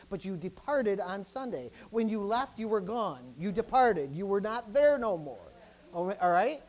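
usual speaking voice

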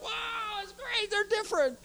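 way up high, bursting with excitement; very excited. Retold or imitated. Pastor reliving his thrill, squealing high with joy. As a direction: squeal it like a kid with a secret. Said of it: "I was really excited".

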